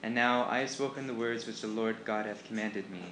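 ordinary delivery, nothing marked

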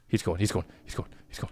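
hushed whisper, barely any voice. Real, not acted. Player hiding from a monster whispers to himself. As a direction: breathe it so the monster won't hear.

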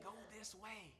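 very soft, hushed urging; hushed whisper. Acted. Holy Spirit nudging a believer in the ear, hushed whisper. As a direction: drop right down as if whispering in an ear. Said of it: "whispers in your ear saying".

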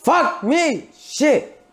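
high-pitched cry of frustration; pained, high swearing; real exasperated outburst. Real, not acted. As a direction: yelp the curses sharply upward. Cursing over the mistake.

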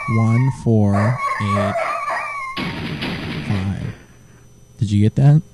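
ordinary delivery

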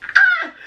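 very high excited squeal; a sudden, bright squeal of joy; real squeal at a gift; delighted squeal. Real, not acted. Sees the box contents.

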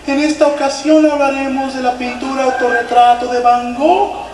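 mock the pretentious guide, stretching it out; fake posh guide voice. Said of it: "el guía fingía la voz".

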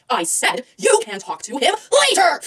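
insistent snap, stressing LATER; mock-angry insistence; irritated outburst. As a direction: repeat it crossly, hitting LATER. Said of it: "that side of me".

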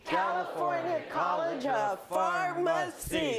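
shouted with gusto; rousing cheer. Shown as a demonstration. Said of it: "we'll do it really with a lot of gusto".

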